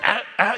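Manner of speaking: forced mock burp sounds; imitated burp. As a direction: fake a belch. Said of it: "se obligaba a eructar". ([name] eructa a propósito, eructo imitado)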